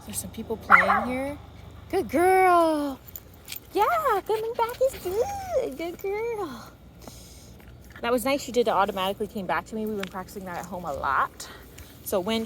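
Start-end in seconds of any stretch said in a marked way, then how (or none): 1.9-6.7 high, sing-song praise for a puppy; praising the dog. Real, not acted. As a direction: cheer her on like a happy dog owner.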